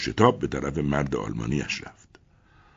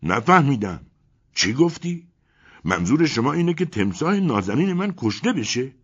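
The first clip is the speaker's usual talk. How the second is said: higher, louder shocked outburst; loud cry; 9 semitones higher, 5 dB louder than usual. A narrator's reading. German man shouting in shock at narrator, loud. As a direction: cry out as if shot. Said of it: "فریاد بلندی زد و گفت".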